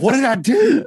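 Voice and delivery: mock-panicked, high-pitched question; retold freak-out. Man retelling his panic over a warrant, high mock alarm. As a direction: imitate yourself freaking out, pitch jumping up. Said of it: "I started freaking out".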